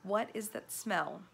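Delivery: ordinary delivery, nothing marked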